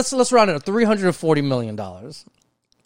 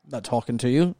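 a hint of a snooty rich man; 3 semitones lower than usual. Mocking how a rich suspect snubs police, lightly snooty. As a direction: lightly put on, dismissive rich guy. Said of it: "That's my rich voice".

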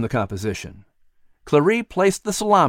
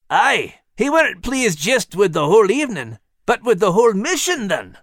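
higher, hearty Scottish brogue; 8 semitones higher than usual. Acted. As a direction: bold Scottish sailor's brogue. Max voiced, brogue words.

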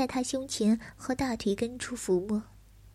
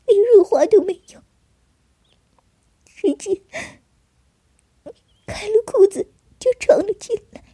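much higher and louder, near screaming; anguished crying outburst; 6 semitones higher, 10 dB louder than usual. Acted. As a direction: cry it out in anguish.

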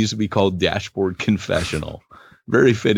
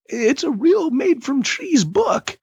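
mock grand; slower than usual. Joking about his real book.

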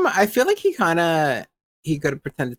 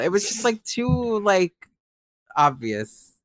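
usual speaking voice

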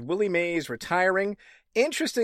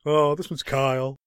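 imitating Clemens. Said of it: "That's how he said it".

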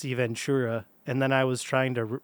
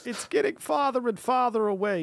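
high whiny mob voice gone wrong; failed Gandolfini, high like a toddler; toddler tantrum voice; 10 semitones higher than usual. Pretend. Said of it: "sound like a toddler".

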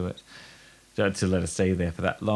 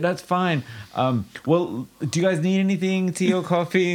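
flustered host; 9 semitones higher than usual. Retold or imitated. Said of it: "I was like".

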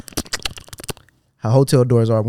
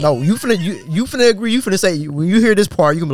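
rising, louder, keyed-up prediction; higher excited jab; excited teasing; 8 semitones higher, 4 dB louder than usual. Real, not acted. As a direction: needle the listeners playfully.

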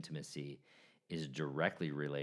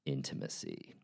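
low, hushed crooner voice; 6 semitones lower than usual. Pretend. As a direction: drop it deep and smooth for romance.

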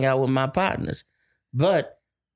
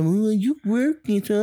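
mocking her excuse; 8 semitones higher than usual. Husband mimicking his partner's excuse to stay home, mock whiny. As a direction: pitch it up and drag it like her lame excuse.

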